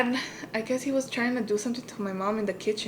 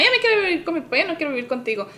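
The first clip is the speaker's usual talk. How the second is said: put-on high, loud whiny kid voice; a little girl insisting, pitched up; childish demand; 6 semitones higher, 4 dB louder, faster than usual. Telling her mom she wants to live with dad, high and whiny.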